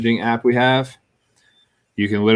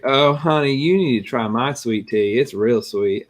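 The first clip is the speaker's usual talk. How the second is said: put-on Southern mom accent; 4 semitones higher, slower than usual. Girlfriend's mom urging him to try her tea, Southern accent. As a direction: coo it like a North Carolina mom offering tea. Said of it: "You got that accent".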